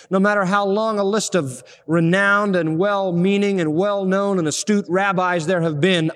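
ordinary delivery

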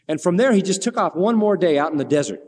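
ordinary talk, nothing marked

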